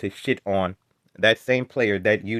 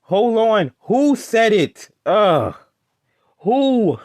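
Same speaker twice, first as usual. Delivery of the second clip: raised, high-pitched protest; exasperated shout of objection; loud outburst; 8 semitones higher, 6 dB louder, much slower than usual. Real, not acted. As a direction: cut him off loudly in disbelief. Heated objection in debate.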